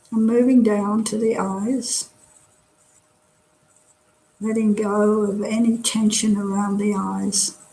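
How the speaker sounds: calm, unhurried meditation guide; guided meditation. Real, not acted. Teacher settling an online class into body scan, guided meditation. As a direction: talk them gently through relaxing, with long pauses.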